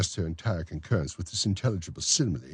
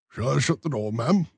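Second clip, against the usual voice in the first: raised, fussy official's voice; pompous beadle; 8 semitones higher, 5 dB louder than usual. Acted. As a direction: play the self-important beadle. Bumble's voice, reader plays beadle.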